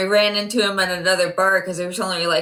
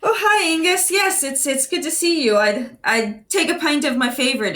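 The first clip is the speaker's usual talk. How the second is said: in character; 8 semitones higher than usual. Acted. Player greets the barman in character.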